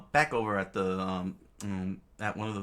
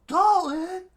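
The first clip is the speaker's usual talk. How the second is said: very high, loud shriek; crowd's scream; 11 dB louder than usual. Acted. Crowd recognising the king's attacker, high scream. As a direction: shriek the name in shock. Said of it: "the crowd screamed out".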